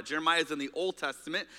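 ordinary talk, nothing marked

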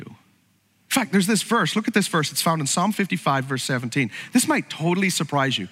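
normal talking voice

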